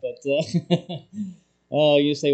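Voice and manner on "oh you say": normal talking voice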